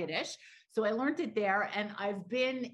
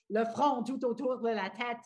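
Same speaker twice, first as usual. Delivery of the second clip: said in French; French phrase; 2 semitones higher than usual. Shown as a demonstration. French expression explained to the radio host. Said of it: "In French".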